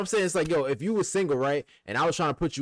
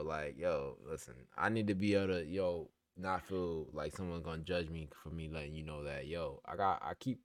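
quiet confiding; 7 semitones lower, 10 dB quieter than usual. Real, not acted. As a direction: lower and much softer. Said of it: "confiding lower, softer voice".

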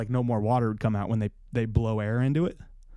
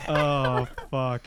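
usual speaking voice

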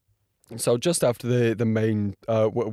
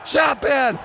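excited live commentary; 14 semitones higher than usual. Real, not acted. Vlogger calling the title-deciding lap live, screaming excitement. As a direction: call the final lap like a frantic commentator.

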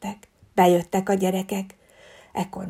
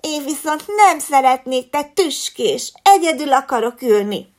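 higher, louder snooty declaration; haughty refusal; 5 semitones higher, 5 dB louder than usual. A narrator's reading. The fox girl snubs the hedgehog, loud and haughty. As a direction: sneer at the prickly hedgehog. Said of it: "jelentette ki Regina".

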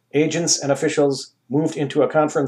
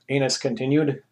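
usual speaking voice

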